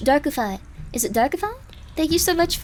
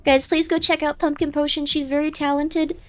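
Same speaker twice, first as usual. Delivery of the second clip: normal talking voice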